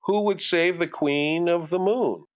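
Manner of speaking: plain reading voice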